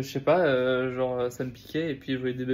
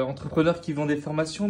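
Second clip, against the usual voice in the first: normal talking voice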